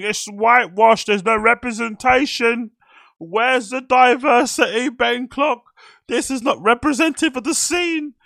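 high, loud mock protest; outraged online activist. Imitating social media complainers at [name], mock outrage. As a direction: shout it in a hammed-up, high-pitched whine of outrage. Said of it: "one of those rah, rah, rah people on social media".

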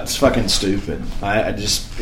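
ordinary delivery

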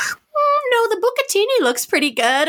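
put-on high, musing little-kid voice; discerning child foodie. Child turning down chicken fingers, high and choosy. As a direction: politely decline like a small gourmet.

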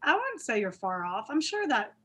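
ordinary delivery

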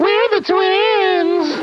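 put-on very high, very slow child voice; sing-song eerie twins; creepy twin kids. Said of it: "They say that at the same time".

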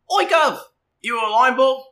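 high, loud cockney urchin call; a street kid hailing the line bull; cheeky street kid. Acted. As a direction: shout up at him like a grubby street kid. GM voices street kid calling out.

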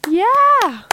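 loud, high cheer. Shown as a demonstration. Recording a cheer for the soundboard, loud and high. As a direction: whoop like the crowd just scored. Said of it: "So you just gotta do it".

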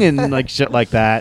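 ordinary delivery

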